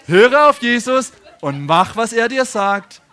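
big preacher's exhortation, stretched out; emphatic preaching. Real, not acted. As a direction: hammer each word into the congregation. Preacher repeats key line at force.

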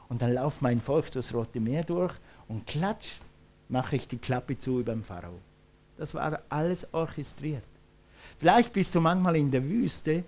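normal talking voice

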